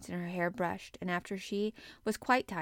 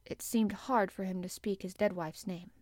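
plain reading voice

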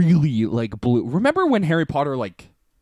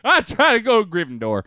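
put-on Alabama Southern accent; 6 semitones higher than usual. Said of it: "like, Southern, like, Alabama".

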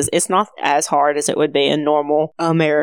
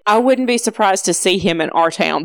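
normal talking voice

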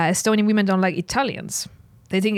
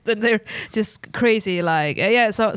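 ordinary delivery

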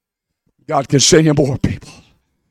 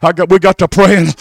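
ordinary delivery

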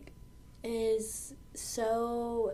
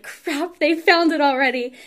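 panicked inner voice; 7 semitones higher, 9 dB louder than usual. Retold or imitated. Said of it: "I'm like".

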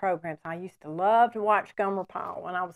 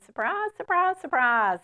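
high, stretched hillbilly surprise; Gomer Pyle impression; 7 semitones higher than usual. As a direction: squeal it like an amazed country boy. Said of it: "he'd just always be saying".

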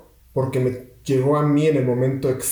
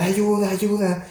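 mock cry for help; 7 semitones higher, slower than usual. Young hero pinned under the rubble, mock cry for help. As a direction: imitate a kid wailing for help. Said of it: "comienza a gritar".

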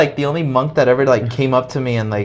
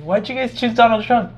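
much higher, foreign-sounding put-on monk voice; Thai monk voice; 12 semitones higher than usual. A monk answers the student's "America," high and eager.

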